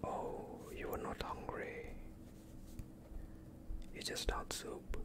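ordinary talk, nothing marked

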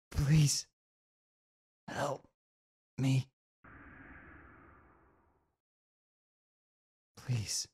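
high, breathless cry for help; frightened, fading call for help; desperate plea. Acted. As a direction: beg like you're dying alone. Dying character begs for help.

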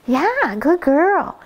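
high, lilting coo of praise; puppy praise. Shown as a demonstration. Trainer shows how to praise a puppy, high coo.

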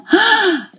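a high, sudden intake of breath; sharp gasp. Retold or imitated. Mother reliving the moment she noticed the silence, sharp gasp. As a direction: gasp as if the room has just gone silent. Retelling finding her son gone.

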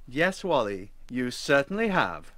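plain reading voice

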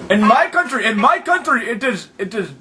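put-on Arabian accent, lively and insistent; hammed-up Arabian-accented prank voice. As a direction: play an indignant caller with a thick Arabian accent.

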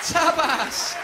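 loud mock-shocked cry; comedian's triumphant payoff; punchline exclamation. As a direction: shout the punchline. Punchline realisation.

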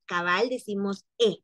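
ordinary talk

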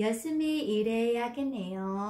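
Yoshimi ireyageneo read slowly but with the sounds linked smoothly; teacher shows the smooth corrected Yoshimi ireyageneo; slow, flowing model reading. Shown as a demonstration. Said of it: "Còn đây đọc lại nè".

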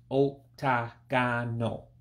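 octagonal slowly broken up, ohk, tah, gah, noh; syllable by syllable. Shown as a demonstration. Breaking the word down for learners, syllable by syllable. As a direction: spell out octagonal in slow, separate syllables. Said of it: "it's just a few syllables".

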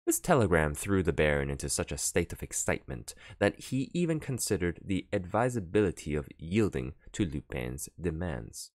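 ordinary delivery

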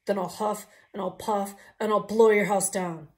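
loud, menacing threat; the pig roaring at the wolves; pig's threat. Acted. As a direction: bellow the threat.

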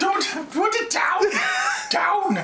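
laughing shout. Real, not acted. Laughing panic over drink.

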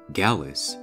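ordinary delivery, nothing marked

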